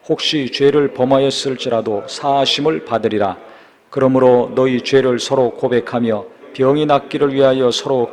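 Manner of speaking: loud, steady reading of scripture. Acted. Preacher reads James to the congregation, loud formal scripture reading. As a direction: read it out firmly and evenly to the congregation.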